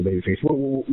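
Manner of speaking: ordinary delivery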